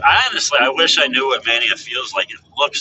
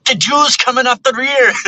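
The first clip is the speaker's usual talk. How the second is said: much higher and a bit louder, a hammed-up accented race call; a silly put-on accent calling the race; keeping up the joke as an accented race caller; mock announcer accent; 13 semitones higher, 4 dB louder than usual. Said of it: "mock race announcer voice".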